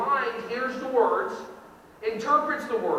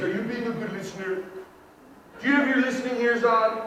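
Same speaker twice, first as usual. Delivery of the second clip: put-on teacher to kid; 2 semitones lower than usual. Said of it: "ever said to one of your kids".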